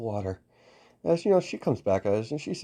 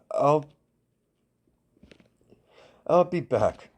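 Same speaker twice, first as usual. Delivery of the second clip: flustered; 6 semitones higher, slower than usual. Acted. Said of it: "immediately turned red and blushed".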